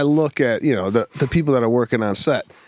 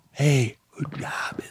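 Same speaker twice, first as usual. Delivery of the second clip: quiet mock praise; 3 semitones higher, 6 dB quieter, slower than usual. Imagined praise that never came.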